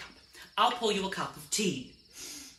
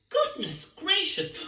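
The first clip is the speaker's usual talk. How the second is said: ordinary delivery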